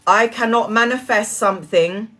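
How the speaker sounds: ordinary talk, nothing marked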